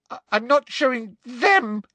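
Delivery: high, piercing refusal; Hermione's high, shrill protest. A narrator's reading. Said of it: "said Hermione shrilly".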